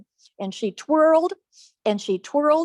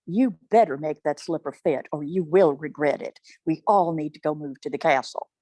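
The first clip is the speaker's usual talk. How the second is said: low, firm warning to her daughters; menacing low-pitched parental order; threatening mother; 6 semitones lower than usual. Acted. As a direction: drop low and lay down the law.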